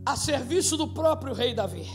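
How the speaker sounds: ordinary talk, nothing marked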